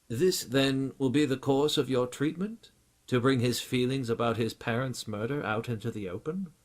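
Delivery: a touch of the loyal English butler; lightly voiced butler. Alfred asks about treatment.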